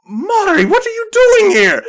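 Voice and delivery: high, loud shout of surprise; astonished cry. Acted. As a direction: cry out in amazement at an unexpected arrival. Game master acts surprised reunion.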